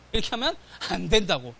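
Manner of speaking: usual speaking voice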